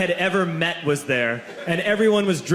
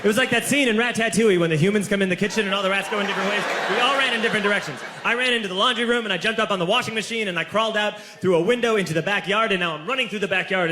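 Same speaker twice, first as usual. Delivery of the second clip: breathless rush; 4 semitones higher, faster than usual. Acted. Frantic escape from the police.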